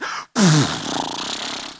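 put-on a deep, dragged-out groan of a teen; long low sulky sigh. Shown as a demonstration. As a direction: groan like a kid told to turn off games. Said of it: "a noise that comes out of their body".

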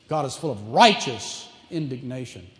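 usual speaking voice

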